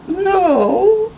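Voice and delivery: put-on bright little girl no. Viviana answers Henry.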